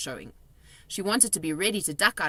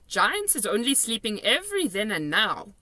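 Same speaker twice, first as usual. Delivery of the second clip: higher, louder friendly giant voice; kindly giant, raised voice; BFG giant voice; 5 semitones higher, 4 dB louder than usual. A narrator's reading. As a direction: play the big friendly giant, chatty and odd. BFG voiced in read story.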